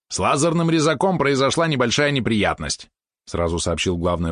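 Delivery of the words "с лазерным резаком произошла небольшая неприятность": high, lively pet character; eager pet helper. Acted. Valeron breaks bad news to Mitya, lively high voice. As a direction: report it in a higher, perky helper voice.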